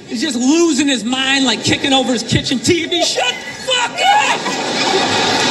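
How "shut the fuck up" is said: very high, dragged-out shout; mock enraged scream. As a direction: stretch out a raging scream at the TV. Idiot kicking his TV over.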